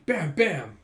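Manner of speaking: loud bam bam, punching the air; mock punch noises; punch sound effects. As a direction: bang it out like two hits.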